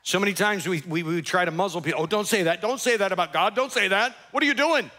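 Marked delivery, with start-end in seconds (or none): 2.0-4.9 mock-panicked hushing, climbing higher; rising mock alarm; alarmed scolding. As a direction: imitate a scandalised shusher, pitch rising to a peak. Mimics people muzzling the angry.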